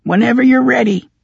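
squeaky high goblin voice. Acted. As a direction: voice him as a tiny goblin. Goblin speaks on.

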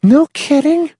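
put-on high, shocked girl voice; horrified. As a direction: gasp it out, shocked.

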